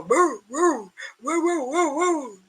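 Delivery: light yipping woos; imitated dog barking. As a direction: bark like the dog in the woods. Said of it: "how they bark".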